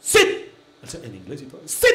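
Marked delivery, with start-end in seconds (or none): 0.0-0.2 short, sharp one-word order; dog command. Shown as a demonstration. Owner commanding the dog in English, short sharp order. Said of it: "todo en la orden". 1.6-1.9 short, sharp order in the same tone; dog command. Shown as a demonstration. Owner showing the tone works in English, sharp command. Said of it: "si usted lo da en la misma".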